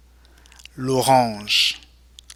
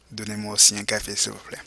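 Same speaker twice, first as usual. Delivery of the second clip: fast quiet repeat; 3 semitones lower, 7 dB quieter, faster than usual. Shown as a demonstration. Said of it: "go ahead and repeat".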